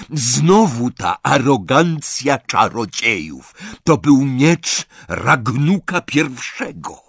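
proud and wronged, lay down the ultimatum; indignant goblin. Acted. Goblin tells the wizards to choose, slow and indignant. Goblin lectures on stolen sword.

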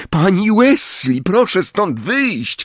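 high urgent call, slowing on the order; a boy shouting at Mrs Weasley to leave. Acted. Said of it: "krzyknął Harry".

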